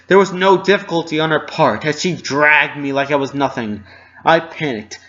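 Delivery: tense, desperate narration; rising panic. A narrator's reading. Narrator panics, dragged into room.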